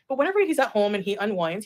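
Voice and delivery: ordinary delivery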